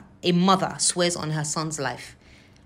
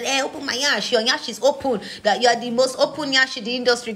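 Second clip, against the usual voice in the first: higher, louder mock yell; imitating a shouting rival; 6 semitones higher, 4 dB louder than usual. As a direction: throw her insults back as a hammed-up shout. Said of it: "Can you go shout and scream?".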